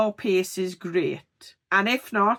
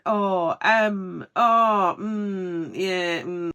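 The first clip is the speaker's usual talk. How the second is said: hesitant ums and ohs; hesitant filler words; much slower than usual. Shown as a demonstration. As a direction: stumble through the fillers. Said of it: "you find yourself saying".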